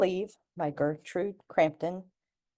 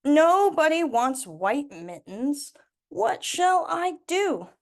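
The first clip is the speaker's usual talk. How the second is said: slow, louder, rising worried plea; worried old woman; 10 semitones higher, 5 dB louder, slower than usual. Acted. Granny Glittens despairing to herself, slow worried plea. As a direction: wring your hands over it slowly.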